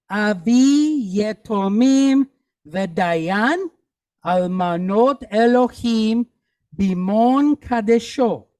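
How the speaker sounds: slow, measured choral reading; slow read-along. Shown as a demonstration. Teacher reading the sentence together with class, slow and measured. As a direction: draw out each word so others can join. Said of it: "let's read it together".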